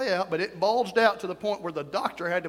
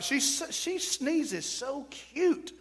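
high, soft, drawn-out coo; cutesy gushing; 6 semitones higher, 4 dB quieter, much slower than usual. Retold or imitated. Preacher reacting to his daughter-in-law's videos, cutesy coo. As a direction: coo it slowly, as over something adorable.

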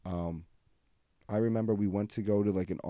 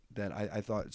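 ordinary delivery

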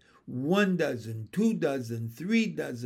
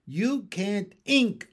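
raised, slow exasperated cry; mild scream; 4 semitones higher, 4 dB louder, slower than usual. A narrator's reading. Frog wife corrects her husband, mildly screaming. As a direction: draw it out loudly, higher in pitch. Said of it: "I screamed".

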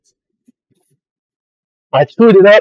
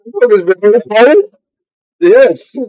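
ordinary talk, nothing marked

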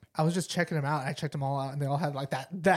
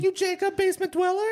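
much higher, broken-English stranger; high mock woman's voice. Eager fan recognising the podcaster in a high mock voice. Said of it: "She's like".

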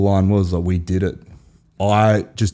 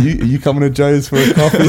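laughing; 7 semitones higher, 6 dB louder, faster than usual. Retold or imitated. Retold invitation, laughing.

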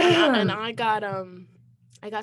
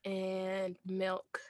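normal talking voice

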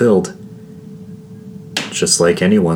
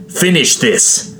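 high, drawn-out command; seething queen's command; furious order; 10 semitones higher, slower than usual. A narrator's reading. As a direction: order the kill, slowly and pitched high. Wounded queen orders her guard.

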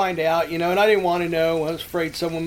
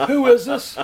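higher, louder voice of the startled woman; surprised woman; 7 semitones higher, 6 dB louder than usual. Retold or imitated. Woman answering the payphone, startled, asking who's calling. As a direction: ask sharply who's calling.

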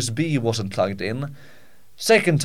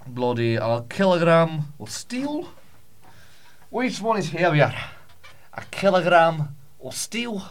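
higher, sing-song put-on Scottish voice; a daft Scottish shepherd, pitched up high; mock Scottish accent; 6 semitones higher than usual. Practicing the Scottish detective voice, high mock Scottish accent. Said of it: "I should just practice it".

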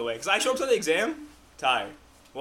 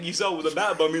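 ordinary talk, nothing marked

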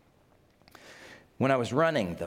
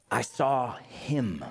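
ordinary delivery

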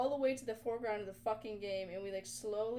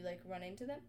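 ordinary talk

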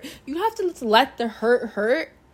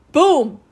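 loud mock boom; 5 semitones higher, 12 dB louder than usual. Bricks all collapse.

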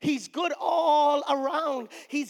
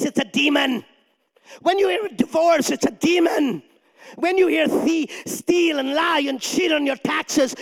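full-force preaching; 4 semitones higher, 5 dB louder than usual. Acted. Preacher rebuking the congregation, shouting in full force. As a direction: preach it, rising to a peak.

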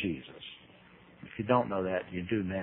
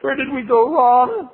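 mock dismay; 14 semitones higher, 8 dB louder than usual. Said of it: "Everyone's going".

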